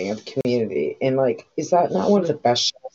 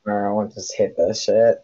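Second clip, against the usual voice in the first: ordinary delivery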